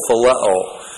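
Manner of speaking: phileo said fil-EH-oh, falling at the end; Greek word phileo. Shown as a demonstration. Preacher teaching the Greek word, phileo said carefully. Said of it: "P H I L E long O".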